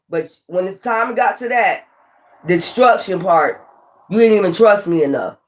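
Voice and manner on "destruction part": ordinary delivery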